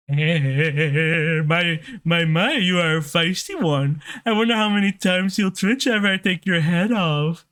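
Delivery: a mild sneering villain, a touch of a laugh at the start; lightly voiced assassin villain. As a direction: toy with the hero as a smug killer, lightly. Voices the villain Garrett Cutthroat.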